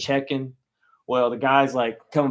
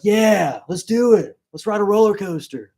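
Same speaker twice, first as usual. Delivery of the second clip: put-on eager kid; 6 semitones higher, 9 dB louder than usual. Kid eager to ride coaster.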